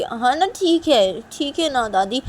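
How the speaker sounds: high, slow, drawn-out agreement; grudging child voice. Acted. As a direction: play a kid giving in to grandma, stretched and high.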